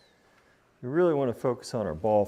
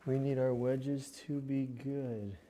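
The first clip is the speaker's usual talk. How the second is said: slow, softer, stretched-out words; drawn-out repeat; 2 semitones higher, 5 dB quieter, slower than usual. Real, not acted. Golfer stressing the need for better wedge play, drawn out. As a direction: draw out each word to press the point.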